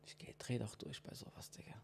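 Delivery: leise geflüstert, ohne Stimme; flüsternd. Real, not acted. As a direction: kaum hörbar vor sich hin flüstern. Fassungslos über Abbuchung. (Flüstern)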